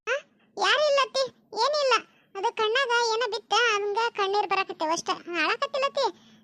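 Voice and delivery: put-on girl denies crying to mother-in-law, tearful; tearful denial. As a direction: insist you're fine through tears. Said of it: "ಏನಾತ ಹಿಂಗೆ ಕಳಕತ್ತಿದೆ?".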